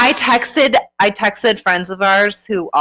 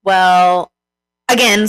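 ordinary talk, nothing marked

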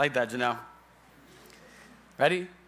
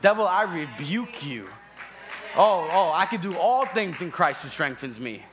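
loud, forceful rebuke; raised-voice declaration of faith; speaking louder; 5 dB louder than usual. Shown as a demonstration. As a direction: drown out the devil with scripture. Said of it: "you're gonna have to speak a little louder".

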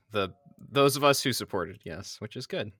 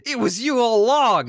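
much higher, louder dramatic outcry; shocked accusation; 12 semitones higher, 9 dB louder than usual. Acted. Hero accusing the traitor, high loud shocked outcry. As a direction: gasp of betrayal.

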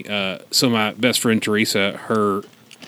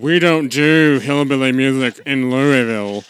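louder haughty rich family voice; snooty refusal; 2 semitones higher, 6 dB louder than usual. Retold or imitated. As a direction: sniff at hillbilly music. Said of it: "And the Bingham said".

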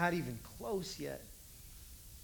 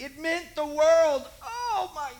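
mock nostalgia; 8 semitones higher, 11 dB louder, slower than usual. Adult mocking past drama.

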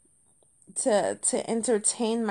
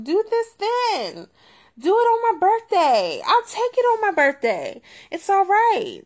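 higher, louder mock plea; exasperated bargaining; 6 semitones higher, 4 dB louder than usual. Comedian asking gadget to die on birthday instead, exasperated bargaining. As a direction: bargain loudly and high, comically wounded.

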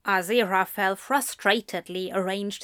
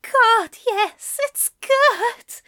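high, breathy strained voice; breathless and strained; 13 semitones higher, 6 dB louder, much slower than usual. Acted. Aziraphale breathless on the phone.

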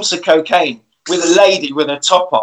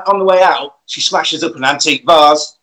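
normal talking voice

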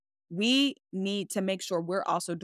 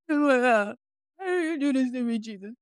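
mock ugly crying; 5 semitones higher than usual. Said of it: "when I say I was ugly crying".

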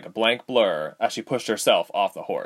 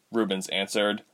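ordinary delivery

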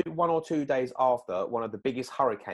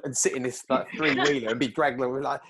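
usual speaking voice

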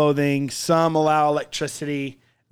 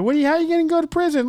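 much higher voice, fast; high put-on quick asker; 14 semitones higher, 4 dB louder, much faster than usual. Mocking an adult questioning the kid, high and fast. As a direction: rattle it off in a mock voice.

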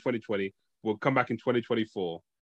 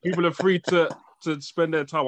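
laughing denial; 6 semitones higher than usual. Real, not acted. Laughs off the accusation.

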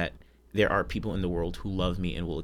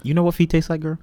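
teasing; 9 semitones higher, 8 dB louder, faster than usual. Real, not acted. Teasing her friend about feet.

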